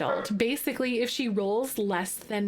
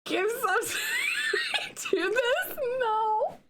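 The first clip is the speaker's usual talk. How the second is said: high, teary laugh-crying; laughing through tears at a sad song; 13 semitones higher, slower than usual. Real, not acted. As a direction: sob and laugh at once.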